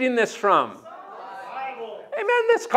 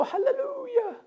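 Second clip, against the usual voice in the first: very high, soft squeak; squeaky mouse voice; 13 semitones higher, 8 dB quieter than usual. Retold or imitated. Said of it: "little squeaky mouse voice".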